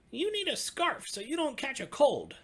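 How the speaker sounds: very high, loud little cub voice; bright high cub piping up. Acted. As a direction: squeak it out like a tiny eager cub. Little Cub fusses over Old Bear.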